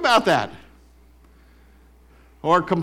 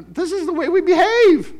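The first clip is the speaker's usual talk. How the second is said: high, loud laughing; laughing mock; 9 dB louder than usual. Real, not acted. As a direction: laugh at our own behaviour. Mocking wilderness behaviour.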